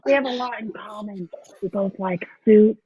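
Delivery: put-on celebrity voice; airheaded movie-character impression; Jennifer Coolidge. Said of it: "give you a little Jennifer Coolidge".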